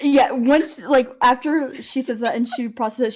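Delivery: ordinary talk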